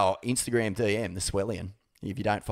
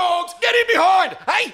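shout; 5 dB louder than usual. Acted. Opening crowd-style chant.

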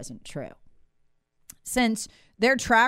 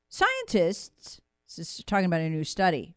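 ordinary delivery

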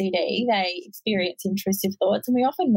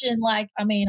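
normal talking voice

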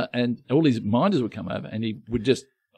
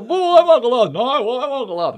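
high put-on old man; hammed-up old codger voice; Uncle Arthur voice; 11 semitones higher, 4 dB louder than usual. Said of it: "someone walked in and go".

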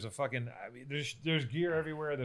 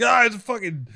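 high, loud mock brag; hammed-up yell about the shirt; mock excited shout; 9 semitones higher, 7 dB louder than usual. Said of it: "You're like".

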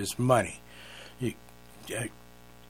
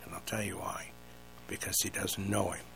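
whispering; 5 semitones lower, 16 dB quieter than usual. Real, not acted. Preacher drops voice for point.

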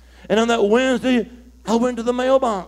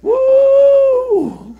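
high, loud "Woo"; loud excited whoop; joyful whoop; 7 dB louder than usual. Real, not acted. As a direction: whoop with joy.

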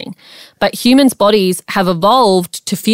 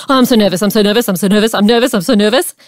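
mock nervous; faster than usual. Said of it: "Instead of saying in your head".

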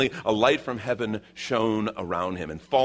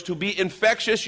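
ordinary talk

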